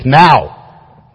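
sharp, punched NOW; single hard staccato "Now!". Shown as a demonstration. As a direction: crack out "Now!" like a rifle shot. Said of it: "like a staccato note in music".

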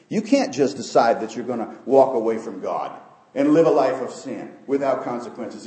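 usual speaking voice

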